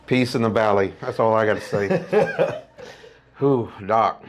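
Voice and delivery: ordinary delivery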